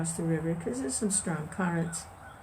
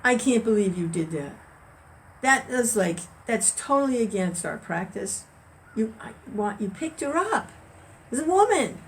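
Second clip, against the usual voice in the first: higher, incredulous scolding monk; the scandalized monk scolding his friend, higher pitched; indignant monk; 5 semitones higher, 4 dB louder than usual. Acted. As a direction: protest in shocked disbelief. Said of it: "the other one pipes up again".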